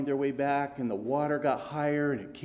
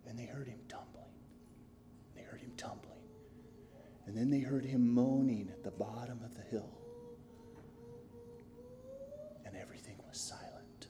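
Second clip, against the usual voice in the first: hushed, breathy storytelling; hushing the listeners after the fall; whispered suspense; 2 semitones lower, 12 dB quieter, faster than usual. A narrator's reading. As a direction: whisper it so the room goes still. Eerie narration after the fall.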